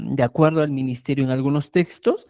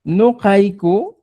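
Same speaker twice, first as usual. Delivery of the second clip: slowed and louder; slow model answer; 4 semitones higher, 6 dB louder, much slower than usual. Shown as a demonstration. Teacher writing the answer out, slow. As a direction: say it slowly for the class. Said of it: "Va a poner".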